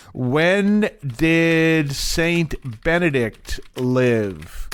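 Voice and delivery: very slow, spaced-out words; slow dictation. Real, not acted. Asking Copilot a question, in slow dictation. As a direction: say each word slowly, one by one.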